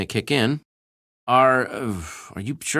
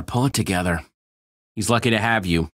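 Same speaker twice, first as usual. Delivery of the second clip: ordinary talk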